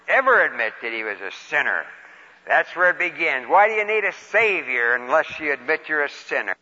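usual speaking voice

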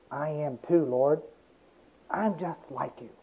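bow your head and say it softly; humble answer. Acted.

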